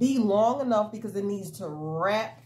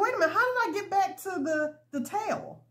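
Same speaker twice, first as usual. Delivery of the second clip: much higher, quick puzzled question; baffled; 10 semitones higher than usual. Retold or imitated. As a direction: act out sudden confusion, high and hurried. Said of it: "I was like".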